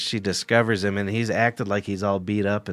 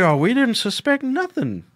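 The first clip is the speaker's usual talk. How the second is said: mock innocent parents; 12 semitones higher than usual. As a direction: play dumb in a high voice. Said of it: "And they were just like".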